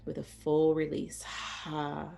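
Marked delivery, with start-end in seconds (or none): 1.2-2.1 soft breathy "ha" on a long exhale; demonstrated cleansing out-breath; open-mouthed sigh. Shown as a demonstration. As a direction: sigh it out with a full release. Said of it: "opening the mouth and sighing it out".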